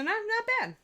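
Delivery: high squeaky not bad; pleasantly surprised. Real, not acted. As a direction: pitch jumps up in delight.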